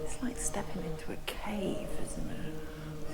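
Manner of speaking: soft whisper inside a chapel. Real, not acted. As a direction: hush it, no voice at all. Quiet inside the monastery.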